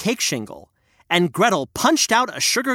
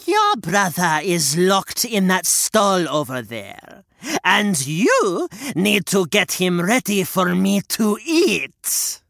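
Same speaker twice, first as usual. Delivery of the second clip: slow, menacing hag; bossy witch; 2 semitones higher, slower than usual. Acted. Witch commands Gretel, menacing hag. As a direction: order her about.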